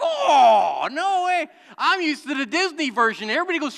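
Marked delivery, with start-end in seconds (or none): 0.0-1.5 higher, louder imitated gasp of disbelief; mock disbelief. Imitating the congregation hearing the original ending, mock surprised. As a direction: play the shocked listener. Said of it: "Some of you are like".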